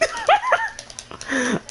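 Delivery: long high squealing laugh; falsetto cackle. Real, not acted. Cracking up at his own joke, high squealing cackle.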